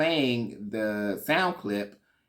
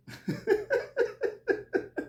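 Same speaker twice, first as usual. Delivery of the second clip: high squealing laugh. Real, not acted. Laughing at the insult.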